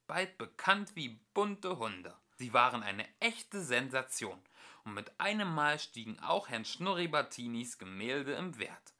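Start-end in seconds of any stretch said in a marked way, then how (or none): none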